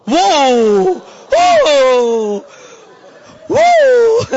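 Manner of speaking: loud drawn-out whoa; American whoa. Retold or imitated. Preacher imitating an American's amazed whoa. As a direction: cry out whoa in awe. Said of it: "how about American culture today".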